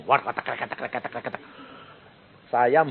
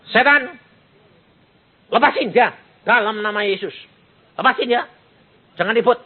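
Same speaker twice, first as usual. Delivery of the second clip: higher, firm rebuke of a spirit; commanding prayer; 9 semitones higher than usual. Acted. Pastor rebukes Satan over the dying mother, higher and firm. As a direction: order the devil out with authority.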